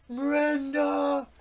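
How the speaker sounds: high, very loud drawn-out holler; imitated scream. Drunk man paddling upstream calls his friend, screaming. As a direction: yell it out across a river. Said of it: "Just screaming".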